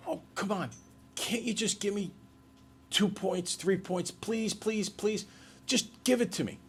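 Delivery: imitating a student whining for points; pleading student. Teacher mimics a failing student begging for points, whiny pleading. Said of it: "they'll look at the teacher and they'll say".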